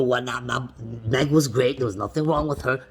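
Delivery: usual speaking voice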